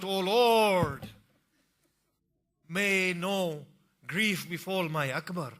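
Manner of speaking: high, loud desperate prayer; crying out. Acted. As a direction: beg the Lord aloud. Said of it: "and cries out".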